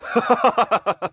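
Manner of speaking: a high head-thrown-back laugh; burst of laughter. A narrator's reading. Lan laughs off Ninévé's refusal, bursting laugh. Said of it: "éclata de rire".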